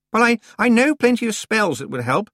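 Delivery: put-on high, loud, complaining girl's voice; whiny young witch. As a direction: whine like a spoiled out-of-depth girl. Anagramma voice.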